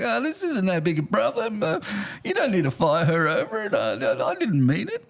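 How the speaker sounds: a hint of a nervous customer taking it back; flustered backpedal. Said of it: "The angry guy st-, started to backtrack".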